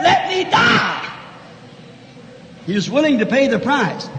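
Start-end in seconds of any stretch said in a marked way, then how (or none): 0.0-1.1 high, drawn-out cry; desperate cry. Acted. Samson asking God to die, high drawn-out cry. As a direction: cry it out slow and high, falling at the end.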